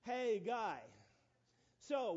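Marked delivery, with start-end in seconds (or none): 0.0-0.8 Haggai said as "Hey, guy"; joke pronunciation of Haggai. Shown as a demonstration. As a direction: turn Haggai into a greeting, falling on guy. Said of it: "alternate translation that you can pronounce it".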